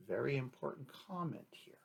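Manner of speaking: hushed confidential remark; whispered aside. Real, not acted.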